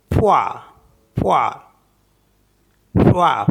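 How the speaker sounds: फ्वा said loud and clipped, d silent; French word. Shown as a demonstration. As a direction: repeat फ्वा with the d silent, loud each time. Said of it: "फ्वा करके पढ़ते हैं ना". (फ्वा ज़ोर से, डी silent)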